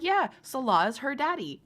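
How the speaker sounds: usual speaking voice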